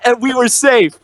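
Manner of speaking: ordinary talk, nothing marked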